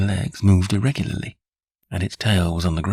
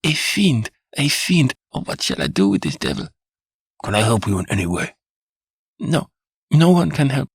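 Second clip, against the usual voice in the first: higher, feverish voice of a delirious wife; delirious despair; 8 semitones higher, 3 dB louder than usual. A narrator's reading. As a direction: rave in despair about the devil. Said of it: "seemed to wander off into delirium".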